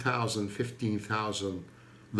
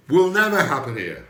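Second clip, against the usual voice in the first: loud emphatic; 4 semitones higher, 8 dB louder than usual. Real, not acted. Repeats the claim for emphasis.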